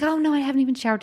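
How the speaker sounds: quick mock-flustered wife; embarrassed wife. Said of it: "And you're like".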